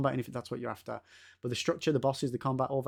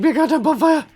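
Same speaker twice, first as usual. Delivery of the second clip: high, loud, laughing plea; 12 semitones higher, 6 dB louder than usual. Real, not acted. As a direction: beg through a laugh. Repeated plea for a bonfire.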